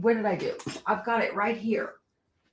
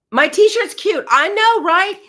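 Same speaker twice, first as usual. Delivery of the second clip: excited; 11 semitones higher, 9 dB louder than usual. Real, not acted. Gushing over her own shirt.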